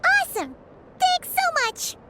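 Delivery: excited thanks. Real, not acted. Thanking the animals for fruit, excited high cheer. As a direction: squeal your thanks for the fruit.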